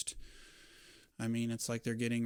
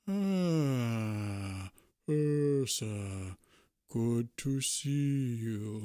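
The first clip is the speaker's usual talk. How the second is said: slow, louder troll voice with a new timbre; slow friendly troll; 4 dB louder, much slower than usual. Acted. Troll shopkeeper warmly greeting his regular customer, slowly. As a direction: draw it out like a big burly troll greeting a friend. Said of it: "he slowly looks up and he says".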